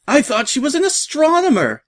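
very high, plaintive wail; hammed-up high-voiced gunner lamenting; despondent wail. A narrator's reading. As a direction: wail it in dismay. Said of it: "one of the starboard gunners despondently wailed".

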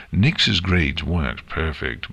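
ordinary delivery, nothing marked

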